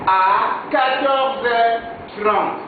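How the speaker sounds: spelled out slowly for learners; slow dictation pace; slow and clear. Shown as a demonstration. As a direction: say each word slowly for the class. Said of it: "Attention ici".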